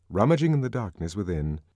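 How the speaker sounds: ordinary delivery, nothing marked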